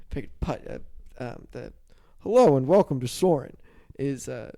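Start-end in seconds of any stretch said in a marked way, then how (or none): 2.2-3.6 loud, sweeping announcer's hello; big, projected ride-host greeting; ride intro impression. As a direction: boom out a theme-park ride welcome. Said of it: "is that a pretty good voice".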